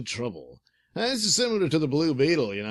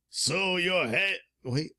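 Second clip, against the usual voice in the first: usual speaking voice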